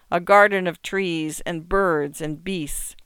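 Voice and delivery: ordinary delivery, nothing marked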